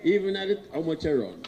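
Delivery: excited. Real, not acted. Lively retelling to crowd.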